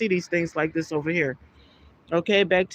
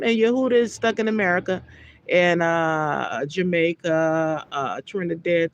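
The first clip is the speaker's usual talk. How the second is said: usual speaking voice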